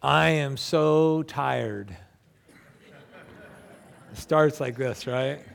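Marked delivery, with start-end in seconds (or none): 0.0-2.0 drawn-out, tired groan of a complaint; weary complaint. Acted. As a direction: stretch the words out as if worn out. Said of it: "it goes something like this".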